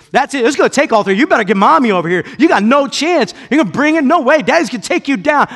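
loud, high boastful taunting; bold and braggadocious. Retold or imitated. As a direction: puff up and dare the kids. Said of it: "bold and braggadocious".

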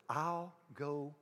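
very soft and low, a hushed tender assurance; hushed voice of God reassuring Joshua; God's gentle promise. Retold or imitated. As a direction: speak as God quietly promising to stay. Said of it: "God says".

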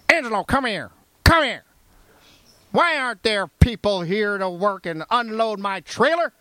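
Southern boss berating. Retold or imitated. Boss berating recruiter before the warehouse, mock Southern accent. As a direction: scold like a hammed-up Southern boss. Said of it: "He'd say like that".

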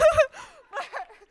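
high, loud burst of laughter; excited high laugh; giddy laugh. Real, not acted. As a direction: laugh out in pure delight.

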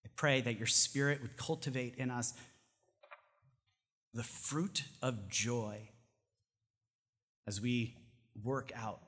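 prayer. Acted. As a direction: close the sermon in hushed prayer. Closing prayer.

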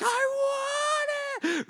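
put-on drawn-out high whine of a little kid; small child whining for a toy; whiny kid. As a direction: stretch it out slow like a child begging for a toy. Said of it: "little kids ... they're like".

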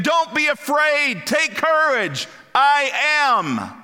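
high, loud, slow proclamation of courage; proclaiming Jesus. Acted. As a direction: boom out Jesus's reassurance, drawn out. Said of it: "as if Jesus is saying".

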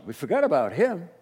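usual speaking voice

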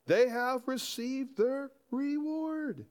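slow, high proclamation; emphatic scripture quote at half speed. Retold or imitated. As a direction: draw out each word emphatically, pitched right up. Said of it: "He's quite emphamat-, emphatic".

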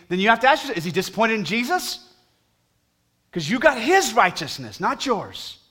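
high, loud sermon delivery; fervent preaching. Acted. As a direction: drive the point home to the church. Preaching at full force.

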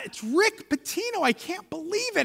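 hushed starstruck disbelief; retold disbelief. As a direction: think it aloud, high and amazed. Retold astonished thought at meeting celebrity.

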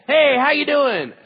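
a booming uncle greets a sleepy niece and scares her; booming greeting. Retold or imitated. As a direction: boom it out like a giant uncle. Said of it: "My voice is huge".

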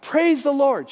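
high, loud cry of praise; cried out loud. Retold or imitated. Neighbor woman calling from her porch, loud praise. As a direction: call it out to the heavens. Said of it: "Every day she came out".

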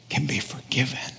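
hushed whisper of good news. Acted. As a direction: breathe the words out as a secret. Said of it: "whispered good news".

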